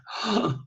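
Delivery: sudden high gasp of realisation; startled gasp of insight; sharp gasp. Retold or imitated. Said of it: "I just went".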